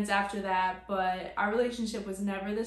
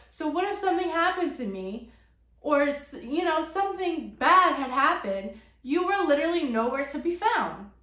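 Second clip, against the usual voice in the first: higher, louder, more upset as it builds; rising upset; 6 semitones higher, 4 dB louder than usual. Retold or imitated. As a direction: build the outrage line by line. Arguing with mom on phone.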